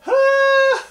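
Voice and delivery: loud high burst of laughter. Acted. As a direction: throw back your head and laugh out loud, high. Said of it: "he laughed very loud".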